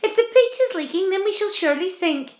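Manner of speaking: high, loud and panicky; alarmed cry. A narrator's reading. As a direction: cry out in dread of sinking. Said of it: "cried the earthworm".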